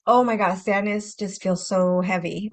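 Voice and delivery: ordinary talk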